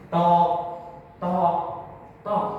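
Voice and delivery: spaced, percussive hammer knocks; ketukan palu. Acted. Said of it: "Suara palu memukul tubuhnya". (tiruan bunyi palu berjarak)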